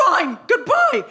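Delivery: high, huffy goodbye; hammed-up huffy farewell; mock storming off. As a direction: flounce out with a slammed-door sign-off. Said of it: "stormed over to the door".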